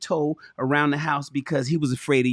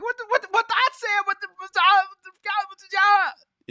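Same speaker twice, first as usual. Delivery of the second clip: high, squeaky mock whine; a high nasal-free whimper of a grown man pouting; whining. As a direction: whine like a sulking kid. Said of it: "you start whining like".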